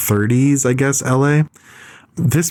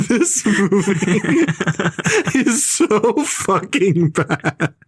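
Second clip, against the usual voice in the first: laughing; 6 semitones higher, much slower than usual. Real, not acted. Laughing while condemning the movie.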